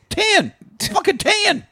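very high-pitched mock demand; Billy Bob. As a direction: imitate a movie character's high yelp.

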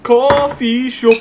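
high, loud, drawn-out New York "cawfee"; slow, hammed-up New York accent; mock New Yorker. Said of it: "that New York accent".

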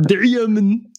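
lightly voiced Schwarzenegger accent; bad Schwarzenegger. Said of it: "a very bad impression of Arnold Schwarzenegger".

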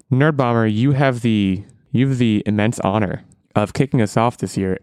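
ordinary talk, nothing marked

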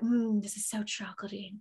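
sultry donut description. Retold or imitated. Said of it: "describe it to me like I'm a lover".

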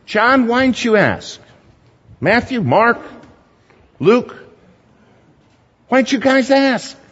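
high-pitched, exasperated call to absent friends; mock calling out. Preacher demanding answers from the disciples who stayed behind, high exasperated call.

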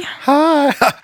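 real squeaky high crack in the voice; puberty voice crack. Real, not acted. Host greeting his wife, voice cracking. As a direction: let the voice jump up and crack. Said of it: "I just had a puberty moment with my voice".

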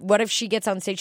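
usual speaking voice

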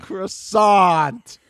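drawn-out mocking "Croissant"; mock put-down. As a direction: scoff her name with a rising sneer. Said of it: "mocking cast member by name".

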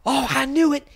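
high, loud mock scream; hammed-up accusing yell at the cop; imitating screaming. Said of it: "Teresa starts screaming at the cop".

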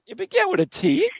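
playful insistence. Real, not acted. Host to caller pleading his case, high and playful.

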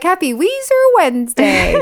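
high sing-song greeting; cheery host greeting; mock cheerful. Teasing the cohost with a mock cheerful greeting. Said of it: "You're like".

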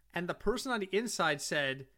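ordinary talk, nothing marked

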